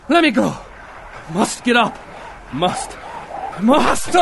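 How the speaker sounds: high, straining cries building to a shout; desperate struggle. Acted. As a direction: force the words out as you fight to wake. Fights to wake from nightmare.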